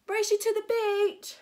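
high, loud challenge; shout. Acted. Preston challenging Max to a race, loud high shout. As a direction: holler it as a dare to race. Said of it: "shouted Preston".

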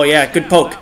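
ordinary talk

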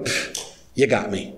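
soft pfft, then a quiet you got me; a dad conceding defeat, amused and soft; resigned dad. Retold or imitated. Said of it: "I go".

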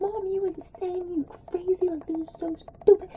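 mock-squeaky protest at a crazy mom; incredulous protest. Daughter protesting to mom beside a moving train, squeaky disbelief.

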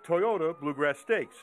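measured, slightly low TV voiceover; news feature narration. A narrator's reading. Reporter recaps the horse's defeat for viewers, measured voiceover. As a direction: narrate the setback like a sports feature.

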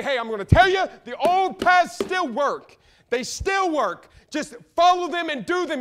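impassioned preacher building to a ringing declaration; full-force preaching. Acted. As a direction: preach it hard, stretching the big words.

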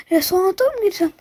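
ordinary talk

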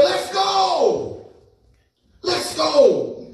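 high mock shout of a challenge. Jumping out to confront him.

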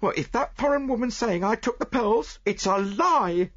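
high, loud indignant maid's voice; a young woman defending herself, heated; heated denial. Acted. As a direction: protest hotly in a high pitch. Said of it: "she declared heatedly".

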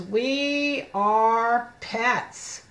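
very slow, each word stretched long; slow model reading. Shown as a demonstration. Teacher reading the book title to children, very slow word-by-word. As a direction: draw out every word separately.

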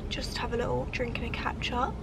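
soft unvoiced whisper. Real, not acted. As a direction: breathe the words out so passers-by can't hear. Whispering while out in public.

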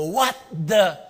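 loud, high, indignant outburst; mock outrage. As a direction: round on someone in acted indignation. Turning to confront who hit him.

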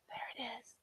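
soft whispered discovery; whispering. Acted.

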